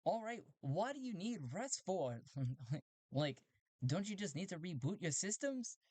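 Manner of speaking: high, puzzled robot voice; a chirpy robot baffled by a human; strange robot. Acted. Robot replying to Talti's message, high robot voice. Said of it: "The robot on the other end goes".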